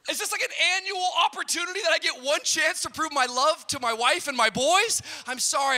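loud, high comic outrage; hammed-up indignant shouting; incredulous protest. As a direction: argue back at full volume in mock disbelief. Mock protest at wife's accusation.

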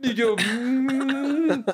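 slow held hum bursting into a high laugh; stifled laugh. Retold or imitated. Son stifles laughter while his stuttering mother scolds him. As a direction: hold back the laugh, then let it out.

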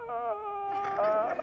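a high, soft crying "uh"; tearful whimper. Retold or imitated. Crying daughter answering her dad, high soft whimper. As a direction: whimper like a little girl about to cry.

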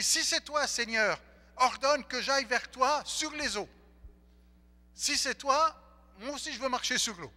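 high, loud and eager plea; eager Peter. Retold or imitated. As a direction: beg to join him on the water. Pierre eagerly asking Jesus.

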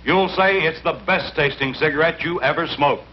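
usual speaking voice

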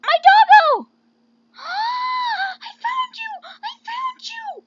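very high, loud drawn-out squeal; overjoyed squeal. Pretend. Player reunited with lost dog, squealing. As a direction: shriek with delight at a lost pet.

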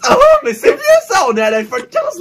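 squeaky high outrage; high mocking squeal. Real, not acted. Mocking old iPhone.

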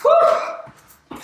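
long, high, unvoiced blow of breath; breathy puff; whispered whew. Real, not acted. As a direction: blow the air out hard. Tired breath out mid-workout.

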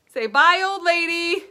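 usual speaking voice

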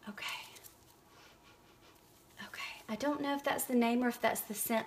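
quick unvoiced whisper to herself. Real, not acted. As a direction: whisper it fast, half to yourself. Wondering aloud while unboxing.

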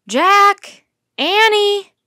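loud, high shout across a campsite; calling out. A narrator's reading. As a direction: call the two names from far off, high and loud. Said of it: "a voice called from the campsite".

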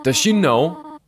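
usual speaking voice

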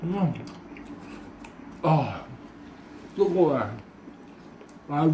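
chewing while talking; breathing out over hot food; mouth full of hot food. Real, not acted. Seen 2: Bite was before; mouth full, chewing and blowing while speaking.

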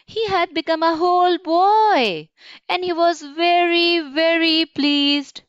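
delighted narration. A narrator's reading. Storyteller reveals the boy is whole at last, delighted.

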